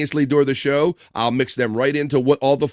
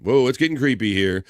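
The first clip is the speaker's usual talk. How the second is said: ordinary delivery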